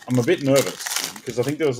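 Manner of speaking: ordinary delivery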